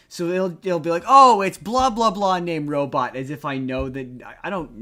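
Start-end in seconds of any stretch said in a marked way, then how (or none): none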